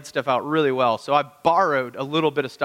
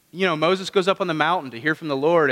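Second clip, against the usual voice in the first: ordinary delivery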